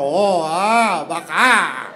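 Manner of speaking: a hint of stern Japanese, drawn out; serious mock Japanese. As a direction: say it slowly and gravely like a calm man turning serious. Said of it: "ele vai virar super sério".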